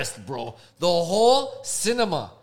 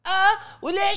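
high, louder mock of the girl; mock chatter; 7 dB louder, slower than usual. Imitating the girl starting up again, high and loud. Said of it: "this fucking bitch was like".

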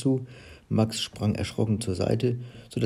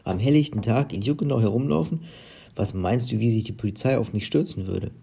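ordinary delivery, nothing marked

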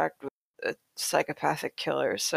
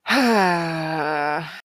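long drawn-out groaning sigh; exasperated groan. Real, not acted. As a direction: let out a long, despairing groan. Dismay at what was read.

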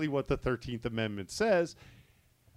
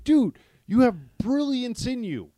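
exasperated plea; 8 semitones higher, 6 dB louder than usual. Retold or imitated. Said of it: "where it's just like".

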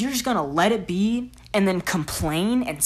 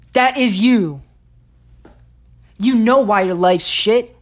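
angry shout; 3 semitones higher, 11 dB louder than usual. Real, not acted. Yelling at the viewer that it's their fault, angry shout. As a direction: blast it straight at them.

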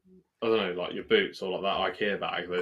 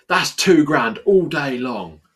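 slow, louder, put-on seller voice; boastful seller; 6 semitones higher, 12 dB louder, slower than usual. Seller pricing a rusty bike, slow loud boast. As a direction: drag out the boast with swagger.

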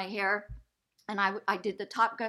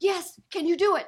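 excited quote; 9 semitones higher, 6 dB louder than usual. Retold or imitated. Phone call retold, excited ask.

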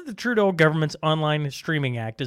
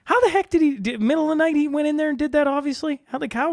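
amazed disbelief; 12 semitones higher, 3 dB louder than usual. Real, not acted. Amazed at the lawn prank.

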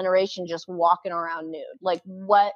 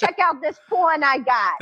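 badly faked New York accent, higher pitched; [name]'s bad New York accent; 6 semitones higher, 4 dB louder than usual. Friend shows off porn he brought over, bad New York accent. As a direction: attempt a hammed-up New Yorker, badly. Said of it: "I can't do the New York accent".

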